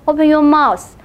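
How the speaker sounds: usual speaking voice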